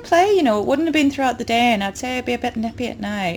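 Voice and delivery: thick Yorkshire, dead flat delivery; deadpan Yorkshire accent. Retold or imitated. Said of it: "the accent was turned up to fucking ten. And deadpan".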